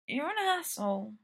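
slurring drowsily; sleepy slurred words. Acted. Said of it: "words slurred".

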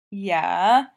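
YEAH stretched long and rising, loud; loud, lingering YEAH with a rising end; drawn-out word. A narrator's reading. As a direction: draw out YEAH into a questioning lilt. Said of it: "drawing the word out".

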